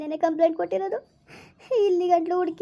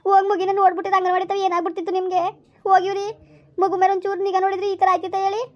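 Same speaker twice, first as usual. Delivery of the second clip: louder, fast furious rebuke; angry scolding; 3 semitones higher, 4 dB louder, faster than usual. Acted. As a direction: lash out at the husband. Wife scolds husband over lost child. (ಗಂಡನನ್ನು ಕೋಪದಿಂದ ಜೋರಾಗಿ ಬೈಯುವುದು)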